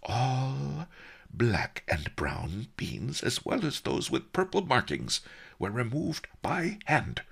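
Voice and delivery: high, deliberate offended answer; huffy. A narrator's reading. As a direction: list it with ruffled dignity. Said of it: "Master Li said huffily".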